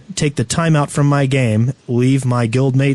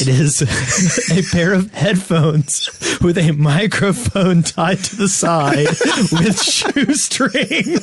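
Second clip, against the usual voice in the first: giggling through the description, voice climbing; laughing; 6 semitones higher, slower than usual. Real, not acted. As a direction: laugh while you describe it. Laughing while describing headset.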